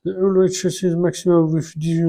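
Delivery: halting English in a French accent. Real, not acted. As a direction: struggle through English with French sounds. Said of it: "mon accent anglais". (anglais hésitant à l'accent français)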